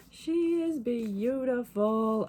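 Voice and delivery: slow, drawn-out gushing; awed delight. Real, not acted. Admiring her new pre-ordered book, awed delight. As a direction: savor every word in admiration.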